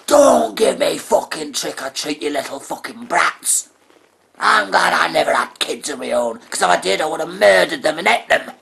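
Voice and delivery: loud, high furious tirade; angry neighbour rant. Acted. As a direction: tear into the kids at full volume. Neighbour rants at trick-or-treaters.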